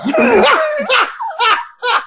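high, hearty burst of laughter; big laugh. Real, not acted. Speaker cracking up at mama's commercial, laughing hard.

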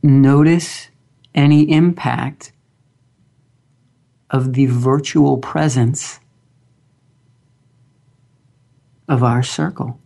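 slow, calm guided meditation voice; grounding exercise. Acted. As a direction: guide them gently into stillness, long pauses.

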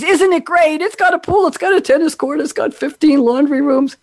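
bright, high mock sales pitch. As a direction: oversell it like a leasing agent. Sarcastic sales pitch for amenities.

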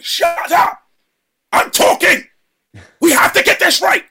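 high, loud barking yell at the whole crew; imitated screaming director. Retelling how the director screamed on set, loud yell. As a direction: bellow it like a furious director. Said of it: "is screaming at everybody".

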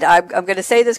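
ordinary talk, nothing marked

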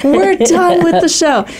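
mock announcement, high, loud, slow and laughing; laughing imitation of the guy at the door; imitating the crew guy. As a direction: play the stagehand calling time, cracking up. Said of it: "the guy's like".

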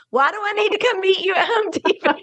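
fast, laughing imitation of a doubtful friend; laughing mock skepticism. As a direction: tease her doubt while laughing. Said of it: "how you were so skeptical".

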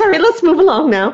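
very high mock-English voice; breezy drive-by apology, English accent put on. Therapist mimicking a spouse moving past a fight, English accent. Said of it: "I put an English accent on there".

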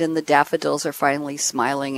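ordinary delivery

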